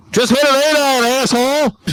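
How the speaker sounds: full-volume, high-pitched shout across the course; exasperated yell. Retold or imitated. Golfer to dawdling players over club choice, exasperated yell.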